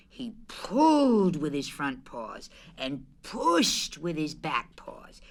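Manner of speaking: usual speaking voice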